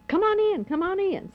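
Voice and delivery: retold calling in. Wife letting her husband back inside, retold calling in. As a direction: call it high, as if retelling her giving in.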